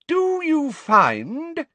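high, loud, drawn-out question; ringing voice. A narrator's reading. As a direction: ring it out slowly for all to hear. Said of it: "in a ringing voice".

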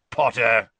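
loud, sudden growled summons. A narrator's reading. Teacher calling up the next student, loud growl. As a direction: growl the name out like a command. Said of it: "Moody growled".

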